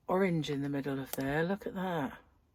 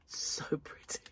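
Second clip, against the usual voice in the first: soft whisper of delight; awed whisper; 10 dB quieter than usual. Real, not acted. Crafter admiring new yarn, whispering in awe. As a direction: breathe it out like a secret.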